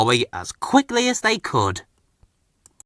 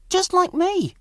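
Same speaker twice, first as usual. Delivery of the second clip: much higher, self-satisfied aside; pleased inner thought. A narrator's reading. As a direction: think it with a proud little lift. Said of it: "he thought to himself".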